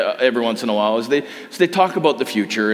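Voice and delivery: normal talking voice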